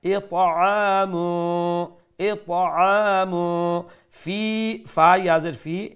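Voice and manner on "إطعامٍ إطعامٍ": slow إطعام with a hummed hidden n ending; ikhfa recitation. Shown as a demonstration. Teacher showing ikhfa twice, drawn-out hum. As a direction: stretch إطعام and hum its tanween. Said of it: "pronounce the tanween with ikhfa".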